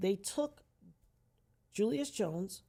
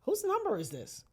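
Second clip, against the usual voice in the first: higher-pitched, irked phone answer; imitated irritated sister; 9 semitones higher than usual. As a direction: answer an unknown caller, pitched up. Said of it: "I hear my sister say".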